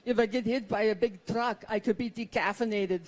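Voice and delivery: put-on husband's Schwarzenegger-style Austrian accent; mock Austrian accent. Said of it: "He talks like Arnold Schwarzenegger".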